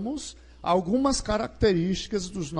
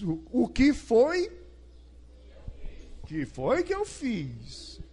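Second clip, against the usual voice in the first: puzzled brother; 4 semitones higher, 4 dB quieter, slower than usual. Acted. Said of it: "Aí você diz".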